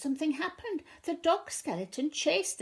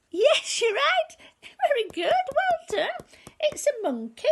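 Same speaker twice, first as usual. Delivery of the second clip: excited praise; 14 semitones higher, 8 dB louder than usual. Acted.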